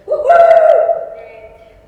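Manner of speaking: high, loud cheer; cheering woohoo. Real, not acted. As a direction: cheer out like a fan. Cheering for Switzerland.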